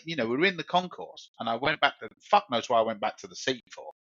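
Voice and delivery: normal talking voice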